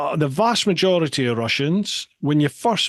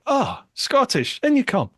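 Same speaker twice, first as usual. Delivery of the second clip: welcoming local; 12 semitones higher than usual. Retold or imitated. Locals greeting the Scottish traveller, high delighted welcome. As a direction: sing it out like a host opening the door. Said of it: "it's like".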